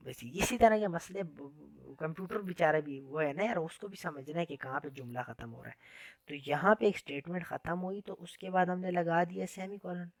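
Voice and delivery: ordinary talk, nothing marked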